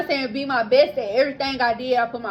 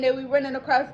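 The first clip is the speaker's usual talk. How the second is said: ordinary delivery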